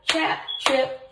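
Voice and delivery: ordinary delivery, nothing marked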